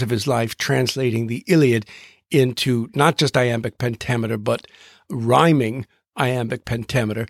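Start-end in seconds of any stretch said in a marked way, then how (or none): none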